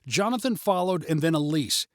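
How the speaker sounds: usual speaking voice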